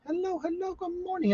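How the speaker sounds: sing-song greeting. Real, not acted. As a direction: lilt the double hello way up, lightly. Greeting a viewer in chat.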